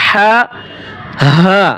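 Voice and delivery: হা with a raspy airy breath; breathy hissing h. Shown as a demonstration. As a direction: push air through for a hoarse হা. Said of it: "ফ্যাস ফ্যাস আওয়াজে". (ফ্যাস ফ্যাস আওয়াজে হা)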